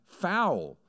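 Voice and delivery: a sharp high cry of foul; mock cry of foul. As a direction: throw up your hands and call foul. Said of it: "wants to throw up his hands and cry".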